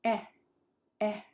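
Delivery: "Eh" as a brief single burst; short vowel. Shown as a demonstration. Teacher modelling what sounds short, quick "eh". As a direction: cut each "eh" short. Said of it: "that sounds short".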